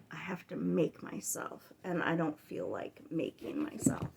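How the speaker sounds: "making myself" whispered under her breath; whispered confession. Real, not acted. Vlogger confessing she doesn't feel like reading, whispering. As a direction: admit it in a whisper.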